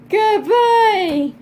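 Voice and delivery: high, loud mock dog voice; voicing the dog mockingly. Owner voicing her dog parroting good boy and water. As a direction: play the dog echoing her commands. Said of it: "He's like".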